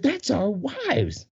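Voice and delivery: high, stretched-out answer; mock protest. Dancer explaining the women to staff, high slow protest. Retold answer about wives.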